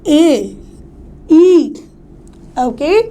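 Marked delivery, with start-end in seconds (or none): 0.1-1.7 "ए" and "ई" sounded alone, held and separate; stressed vowels. Shown as a demonstration. Teacher picking out stressed syllables, "ए" and "ई" held apart. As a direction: sound out "ए", pause, then a firm "ई". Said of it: "जिस पे हम stress दे रहे हैं".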